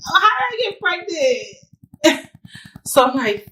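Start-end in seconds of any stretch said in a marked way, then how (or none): 0.1-2.3 crying disbelief. Retold or imitated. Crying at urgent care.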